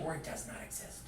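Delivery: breathy whisper, tucked in like a parenthesis; whispered aside. Shown as a demonstration. Teacher slips the exception to the class in a whisper. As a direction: drop to a whisper for the side note. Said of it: "or lightly in parentheses".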